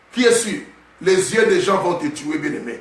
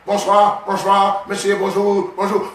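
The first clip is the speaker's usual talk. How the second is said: lightly put-on Chinese accent, greeting; a hint of a Chinese accent saying hello; mock Chinese accent; slower than usual. Preacher imitates a Chinese man greeting, light Chinese accent. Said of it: "quand un Chinois parle".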